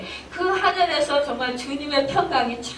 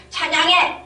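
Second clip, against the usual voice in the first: sudden loud, raised-pitch order; scolding command; 7 semitones higher, 5 dB louder than usual. Acted. God imagined forcing people to praise, scolding. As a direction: force it out as a coercive demand. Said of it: "야단치지 않는다라는 거예요".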